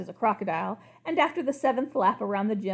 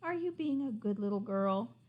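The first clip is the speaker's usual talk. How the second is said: soft, disappointed plea; sad voice; 8 dB quieter than usual. Acted. King asks his daughter sadly, soft and disappointed. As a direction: sigh it out, hurt and gentle. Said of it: "in the sad voice".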